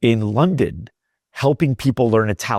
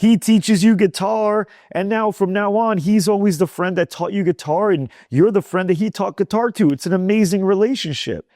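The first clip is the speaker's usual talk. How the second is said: excited explaining; 8 semitones higher than usual. Retold or imitated. Sicilian stranger telling the American friends teach for free, animated and higher. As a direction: pitch it up and lean into every word.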